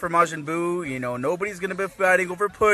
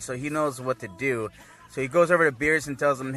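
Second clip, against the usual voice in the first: usual speaking voice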